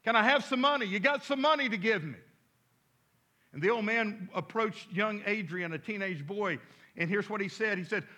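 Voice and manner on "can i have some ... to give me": put-on raised, higher old man calling out; yelled. Old man hails a teenage boy for money, yelled. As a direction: call out loudly to a passing lad.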